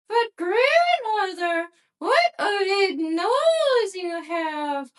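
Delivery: put-on high, slow, sweet little girl. A narrator's reading. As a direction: answer innocently in a high little girl's voice. Girl marvels at the wolf.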